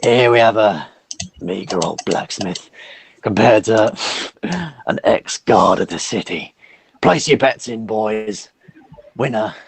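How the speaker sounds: a lightly voiced orc ringleader; hint of a gruff fight announcer; slow, lightly voiced orc hyping up a brawl. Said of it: "the sort of main orc".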